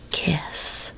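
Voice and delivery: plain reading voice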